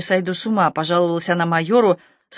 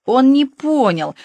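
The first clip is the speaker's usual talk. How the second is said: higher, loud and slow, like a whole squad in unison; chorus confirming; 7 semitones higher, 4 dB louder, slower than usual. A narrator's reading. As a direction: drawl it out as a group chorus. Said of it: "хором подтвердило отделение".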